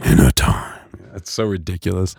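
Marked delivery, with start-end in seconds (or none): 0.0-0.7 much lower trailer growl; movie trailer voice. Retold or imitated. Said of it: "announce it like that".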